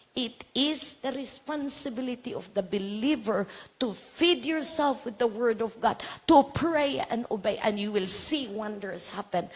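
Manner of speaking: fervent sermon push; preaching. Acted. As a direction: preach it rising to the congregation. Preaching exhortation.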